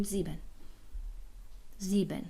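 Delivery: "زیبن" with ie as one ee sound; ie read as i. Shown as a demonstration. Teacher showing learners the ie rule, "زیبن" said with one ee. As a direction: say "زیبن" with a single ee, not two vowels.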